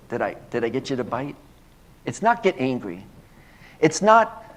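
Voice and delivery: usual speaking voice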